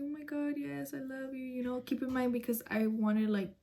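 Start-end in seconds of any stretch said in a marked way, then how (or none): 0.0-1.4 soft, higher, happy gush; retold happy gushing. Woman recalls greeting the man she loved, softly happy. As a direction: gush softly, as if retelling young love. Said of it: "I was, like, really happy".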